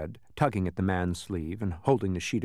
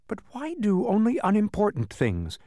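much higher-pitched questioning; puzzled protest; 11 semitones higher than usual. A narrator's reading. Milo asking the Trivium about his jobs, puzzled protest. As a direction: plead for a reason, high-voiced.

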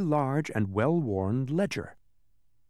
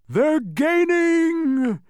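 high, loud, drawn-out cry of alarm; shouted; 8 dB louder, much slower than usual. Acted. Humbug shouting to the others as demons close in, a loud cry. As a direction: yell it back over your shoulder. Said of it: "shouted the humbug".

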